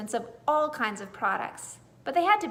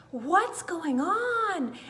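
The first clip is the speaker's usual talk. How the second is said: curious critter; 6 semitones higher, much slower than usual. A narrator's reading. Garden creatures wake.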